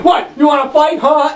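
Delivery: high, shouted tough-guy taunt; mock thug yelling want to fight; aggressive thug challenge. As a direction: bark it like a skinhead picking a fight. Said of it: "the guy said".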